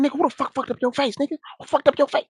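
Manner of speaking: very high, put-on indignant demand; rapid high-pitched mimicry of outrage; imitated heated yelling. Neighbor demanding who hit his brother, imitated heated yelling. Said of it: "like, he heated".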